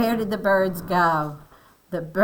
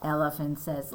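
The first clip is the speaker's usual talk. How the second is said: ordinary delivery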